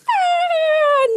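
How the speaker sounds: high, loud shriek; pretend scream. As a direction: shriek as you get squirted. Monster squirted by Dozer.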